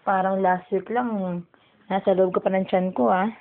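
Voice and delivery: usual speaking voice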